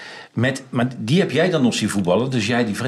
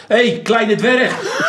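high, slow, laughing taunt shouted out; taunting call; 9 semitones higher, much slower than usual. Retold or imitated. Heckler calling out to the coach, high and laughing. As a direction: jeer at him from behind the dugout. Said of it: "riep ik bijvoorbeeld".

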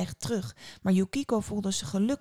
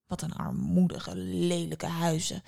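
mildly sighed disdain; sighed complaint. A narrator's reading. Bitter woman on seeing the village, sighing. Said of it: "verzuchtte ze".

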